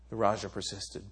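normal talking voice

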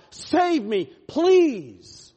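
high, loud cry for rescue; desperate plea. Acted. What we say to God, desperate loud plea. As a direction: cry out to God at full voice, begging.

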